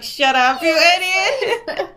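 half-laughing, hammed-up scolding of a fool; laughing mock yell. Crew yelling at the swordsman.

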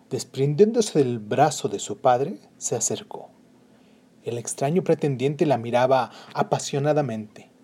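ordinary delivery

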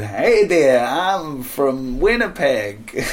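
slow, drawn-out Canadian greeting; Canadian accent. Acted. Canada's reaction to the song, drawling Canadian accent. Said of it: "In Canada, they said".

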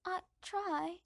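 put-on high meek boy's voice; young Harry. Boy answering his aunt, high and meek. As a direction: answer small and timid. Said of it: "says young Harry".

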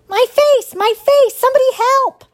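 very high, loud howls; wounded animal's high-pitched wail; howling in pain. A narrator's reading. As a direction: cry out in agony for help. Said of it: "howling in pain".

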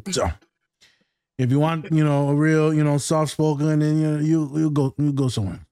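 easy Louisiana Creole lilt; louisiana creole accent. Acted. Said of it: "Why did you get your Creole voice on right now?".

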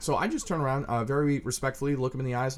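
ordinary talk, nothing marked

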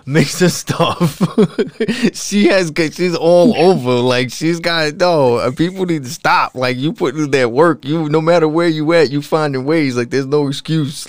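loud, excited hype, cracking into laughs; hyped up. Real, not acted. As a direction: pump the crowd up, loud and laughing. Hyped praise of Karma's work.

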